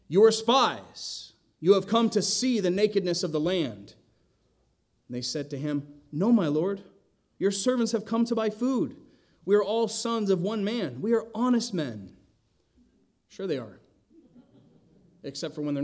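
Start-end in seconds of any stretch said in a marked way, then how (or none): 0.1-3.9 stern accusation. Acted. Joseph accusing his brothers of spying, loud and stern. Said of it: "and he said to them". 6.1-12.1 higher, anxious pleading to a lord; nervous pleading. Acted. Brothers begging Egypt's governor, higher and nervous. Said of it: "You can tell they're nervous".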